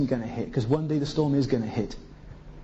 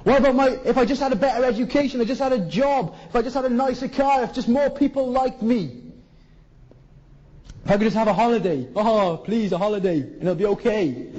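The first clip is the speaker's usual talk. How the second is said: higher, fast pleading whine; mock self-pity; 7 semitones higher than usual. Preacher mimicking self-pitying wishes, fast pleading whine. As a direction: parody someone begging for comfort. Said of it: "says Jesus".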